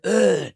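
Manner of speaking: a sharp loud gasp for air, then a strangled "ugh"; dying gasp. Shown as a demonstration. Demonstrating a last dying breath to a friend, sharp gasp. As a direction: gulp one last breath like someone about to die. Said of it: "this gasp to stay alive before you die".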